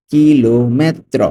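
slow "কিলোমেত্র", each syllable drawn out; teacher's slow-motion model of "কিলোমেত্র"; slowly stretched out. Shown as a demonstration. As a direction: stretch "ki-lo", then "me-tro" for the learner. Teacher repeats word slowly.

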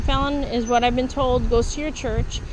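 ordinary talk

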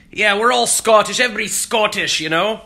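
put-on Scottish accent. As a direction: play a boisterous Scot with a thick Scottish accent. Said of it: "And Scottish, it's like".

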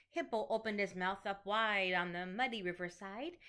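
ordinary delivery

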